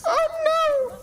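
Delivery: shrieking high oh no; sudden dismay. Real, not acted. Coach yelping as the player gets frozen. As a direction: yelp in sudden alarm.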